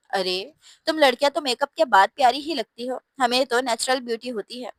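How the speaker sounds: plain reading voice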